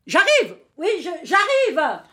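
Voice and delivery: loud call to someone in another room. Acted. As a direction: call out so they hear you from afar. Calling out to someone away.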